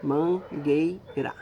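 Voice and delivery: slow, split into syllables: man-guei-ra. Shown as a demonstration. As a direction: draw mangueira out slowly, one syllable at a time. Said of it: "tem três sílabas". (mangueira bem pausada, sílaba por sílaba)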